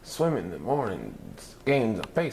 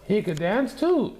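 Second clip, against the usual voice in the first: put-on high, lively kid voice; proud girls; 12 semitones higher, 4 dB louder than usual. A narrator's reading. Velma and mother boasting to reporters, high. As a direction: chime in brightly. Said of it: "said Velma and Miss Lizardo".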